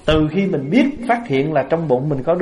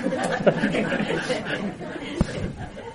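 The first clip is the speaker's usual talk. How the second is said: soft, long, breathy laughter; real laugh; 5 semitones higher, 7 dB quieter than usual. Real, not acted. Speaker laughing at his soup joke, soft real laugh. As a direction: dissolve into quiet giggles.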